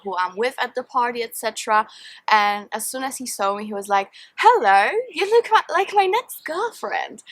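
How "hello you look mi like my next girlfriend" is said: high, bold flirty come-on; retold pickup line. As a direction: playfully imitate a smooth pickup line.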